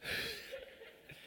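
soft airy laugh at himself; breathy laugh. Real, not acted. Preacher laughing at his own confession to wife, breathy laugh. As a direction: laugh it off quietly.

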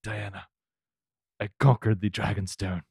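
low, softened character imitation; Jorah Mormont impression. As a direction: drop the voice for a quiet, solemn impression. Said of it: "could talk like Jorah".

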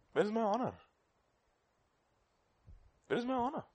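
quiet, high plea; soft rhetorical question. Acted. Preacher voicing God's hurt, soft and high. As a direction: ask it softly, almost hurt. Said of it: "repeats as God, softly".

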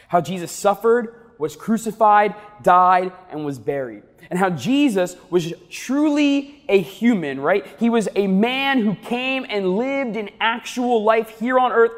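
usual speaking voice

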